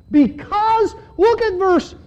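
high, loud preacher's cry, slowing at the end; fervent preaching. Acted. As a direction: drive the point home to the congregation. Preaching at full force.

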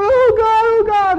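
very high, loud pleading cry; desperate cry to God. Acted. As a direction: cry out to God at full strength. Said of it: "And I'm going".

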